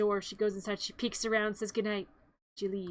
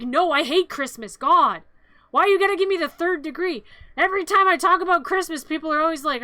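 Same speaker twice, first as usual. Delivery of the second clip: loud, raised-pitch mock exasperation; exasperated; 4 semitones higher, 7 dB louder than usual. Recapper voices the girl venting about Christmas, exasperated. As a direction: play a girl fed up with being questioned.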